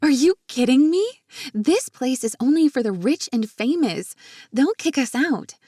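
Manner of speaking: high, loud, astonished protest; yelping disbelief. A narrator's reading. Marina arriving at an exclusive restaurant, yelping in disbelief. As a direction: yelp it out, aghast. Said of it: "Marina let out a yelp".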